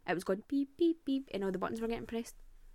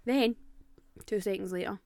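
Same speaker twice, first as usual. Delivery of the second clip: normal talking voice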